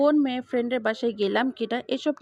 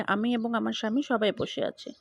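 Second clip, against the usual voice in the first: plain reading voice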